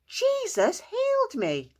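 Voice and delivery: high, loud, slow joyful shout; excited shout. Acted. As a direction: stretch out the shout with joy. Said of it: "he ran into town shouting".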